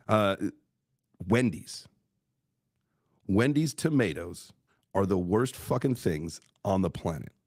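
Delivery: ordinary talk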